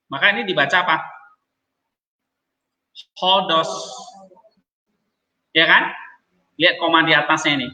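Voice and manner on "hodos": Hodos with an h at the start; rough breathing h. Shown as a demonstration. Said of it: "menghadap kanan komanya". (Hodos dibaca dengan h di depan)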